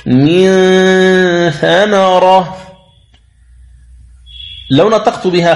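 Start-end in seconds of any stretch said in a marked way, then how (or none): 0.1-2.6 من ثمرة with the ن hidden, slow and nasal. Shown as a demonstration. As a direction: hum the ن of من into ثمرة. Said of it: "تقول".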